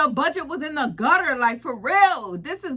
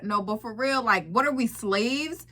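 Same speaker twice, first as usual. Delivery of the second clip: normal talking voice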